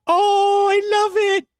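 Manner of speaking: high excited squeal; delighted. Real, not acted.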